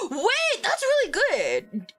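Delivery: gasp, then slow high praise; impressed gasp. Real, not acted. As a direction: sound genuinely impressed. Impressed by viewer's game.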